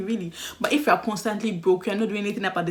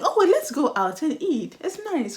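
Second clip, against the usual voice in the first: sweet suggestion; 8 semitones higher than usual. Retold or imitated. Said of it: "I'm like".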